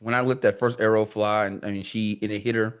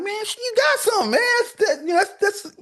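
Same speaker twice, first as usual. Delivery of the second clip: high, laughing mock disbelief; incredulous retort. To a hunter griping about only a doe: high laughing disbelief. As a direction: squeal it out, half laughing at them.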